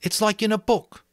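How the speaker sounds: a schoolboy showing off his island; Ralph, excited about the island; boy Ralph, eager. Acted. Ralph voiced.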